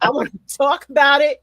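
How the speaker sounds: loud squealing auntie demand; Aunt Mary's voice. Retold or imitated. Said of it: "as in Aunt Mary's voice".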